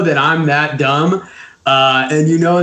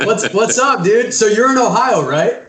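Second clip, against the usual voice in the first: real excited greeting, pitched up; higher, eager hello to a new guest; 6 semitones higher than usual. Real, not acted. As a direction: greet him pumped up. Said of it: "sorry I sound so excited".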